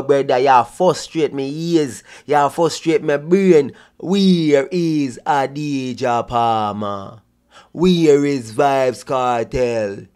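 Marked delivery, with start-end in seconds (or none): 4.0-10.1 dragged out, very slow mock of the reporter; mocking slow talk. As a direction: stretch every word like a painfully slow speaker. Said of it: "You can't talk so slow".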